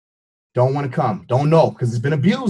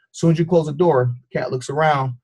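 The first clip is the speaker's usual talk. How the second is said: ordinary delivery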